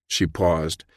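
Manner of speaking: ordinary delivery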